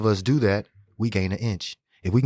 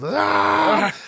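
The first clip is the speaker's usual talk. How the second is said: retold celebratory yell; 11 semitones higher, 3 dB louder than usual. Celebrating the catch, yelling.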